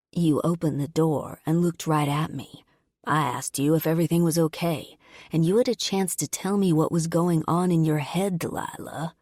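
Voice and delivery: usual speaking voice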